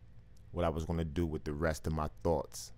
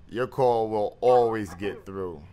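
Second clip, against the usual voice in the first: booming radio voice; 7 dB louder than usual. Acted. Host booming reassurance.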